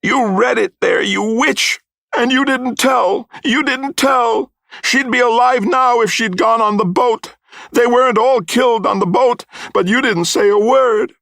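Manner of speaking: high, loud, drawn-out shouting; shouted accusation. A narrator's reading. Mother blaming Missus Grummick for the deaths, shouting. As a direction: shout it like a mother wild with grief and blame. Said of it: "my mother shouted, struggling".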